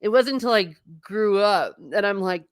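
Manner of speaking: ordinary delivery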